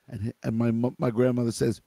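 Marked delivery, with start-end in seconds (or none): none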